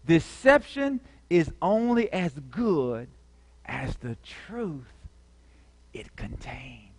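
slow, dropping from soft to a whisper; fading to a whisper. Real, not acted. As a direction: let the line sink into a whisper. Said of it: "Oh, my gosh".

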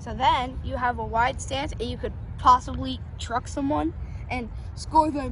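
loud coach on the field; football coaching. Real, not acted. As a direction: bark instructions like a coach. Brother coaching football loudly.

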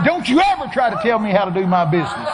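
loud, high scolding shout; Holy Ghost. Retold or imitated. Preacher voices God rebuking his planning, shouted scolding. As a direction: lay down the law at full volume. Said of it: "Holy Ghost said".

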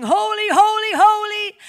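high, loud holy chant; exultant holy, holy; ecstatic worship cry. Pretend. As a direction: cry it out like angels in heaven. Acting out heavenly worship.

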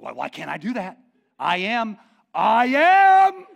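rising to a loud high shout; indignant outburst. Retold or imitated. God answering Moses, building indignant shout. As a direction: build to an outraged yell. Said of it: "And he's like".

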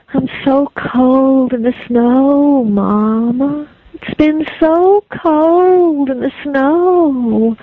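put-on slow, drawn-out ghostly child. Ghost child calling Mama.

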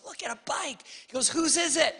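put-on high little-boy voice; tiny kid. Small son asking his parents about the bike, high child voice. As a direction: ask like a tiny boy pointing.

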